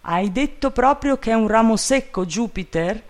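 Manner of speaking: understated trembling shout; trembling cry. A narrator's reading. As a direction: call up in acted alarm. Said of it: "gridò Legrand con la voce tremante".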